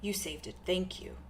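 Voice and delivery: soft, shy voice trailing off; quiet awkward angel. Acted.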